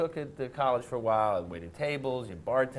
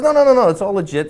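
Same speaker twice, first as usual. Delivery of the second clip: higher louder reassurance; insistent buddy; 10 semitones higher, 11 dB louder than usual. Retold or imitated. Friend insisting the job is legit, higher louder.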